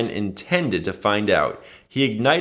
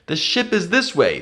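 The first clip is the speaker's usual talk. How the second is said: raised, urgent call over running; cried out; 7 semitones higher than usual. A narrator's reading. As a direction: Finn calling out as they flee. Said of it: "Finn cried".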